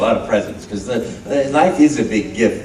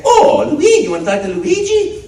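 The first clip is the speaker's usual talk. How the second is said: high, loud cheerful plumber; Mario voice; 4 dB louder than usual. Retold or imitated. Answering a child at a store, a high bright Mario voice. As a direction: delight a kid as Mario. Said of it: "And I'd say".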